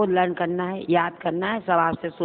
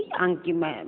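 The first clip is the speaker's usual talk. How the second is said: usual speaking voice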